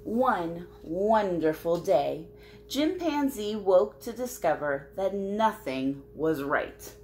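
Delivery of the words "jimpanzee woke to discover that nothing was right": usual speaking voice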